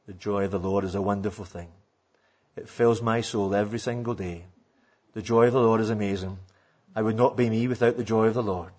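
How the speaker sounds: low, quiet, quick and monotone; dull monotone preacher. Retold or imitated. Preacher at a conference on the joy of the Lord, droning monotone. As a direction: drone through it with no life at all. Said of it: "for fifty-five minutes he did this".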